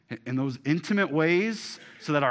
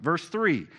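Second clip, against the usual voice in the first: ordinary talk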